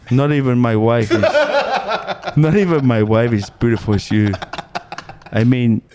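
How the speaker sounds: put-on smitten Tongan-accented suitor; comic Pacific Islander accent, flirting; fob accent. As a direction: chat her up in Islander English. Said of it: "the Islander accents".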